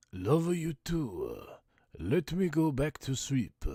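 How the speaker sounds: put-on high, slow Italian accent; drawn-out Italian accent, loud and high. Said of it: "that's just a, your Italian voice".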